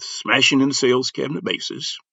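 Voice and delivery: ordinary talk, nothing marked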